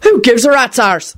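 put-on high, indignant Irish accent; Irishman bellowing. A narrator's reading. Irishman answering a stranger, bellowed Irish accent. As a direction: bellow it back in an Irish brogue. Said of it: "an Irishman bellowed back".

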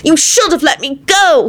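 high, drawn-out angry cry; angry shout. A narrator's reading. Timothy finally speaking after stomping about, shouting. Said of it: "he finally shouted".

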